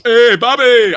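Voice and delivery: loud, high "Hey, Bobby!" yelled across; hammed-up De Niro bellowing at his idol; psycho De Niro shout. Retelling the movie, he yells like the stalker fan. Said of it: "De Niro is just like".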